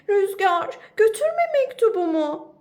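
high, loud desperate cry to the wind. Acted. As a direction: shout after the flying letter. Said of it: "diye haykırdı".

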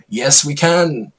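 high, loud, slow slogan; a politician speaking to his wife; Obama. Acted. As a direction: deliver it like a rally slogan. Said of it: "said Obama to Michelle".

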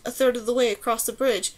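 usual speaking voice

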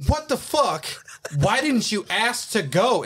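high, incredulous drill instructor cracking out of his frog voice; voice losing bearing. Retold or imitated. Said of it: "he broke his frog voice".

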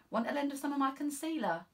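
bright eager constable; young constable, helpful. Acted. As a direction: cheerfully offer the makeup. Constable offering makeup.

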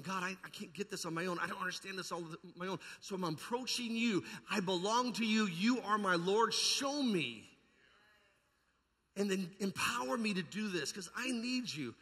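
rising from pleading to a loud cry to God; crying out in prayer. Acted. Preacher voicing a humble prayer, building to loud.

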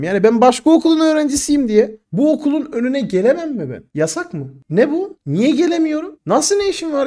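mock-indignant, pushing back at the vice principal; indignant protest. (müdür yardımcısına yükselen öfkeyle itiraz)

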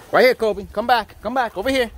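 high calling across the pool; calling from far away. Real, not acted. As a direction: call the dog back from the far end. Calling dog back.

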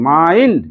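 মাইন্ড stretched as "maa-eend"; long আই sound. Shown as a demonstration. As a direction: rise through the glide in মাইন্ড, then fall. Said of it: "কই আইটা কই করলি?".

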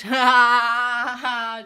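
loud burst of laughter; loud real laugh; big laugh. Real, not acted. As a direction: crack up at your own joke. Laughs at own joke.

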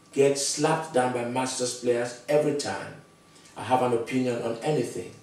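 ordinary talk

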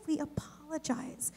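usual speaking voice

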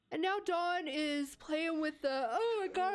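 talking through a yawn; words stretched by a yawn. Real, not acted. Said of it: "I'm gonna yawn?".

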